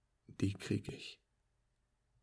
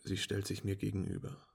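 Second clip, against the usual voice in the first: plain reading voice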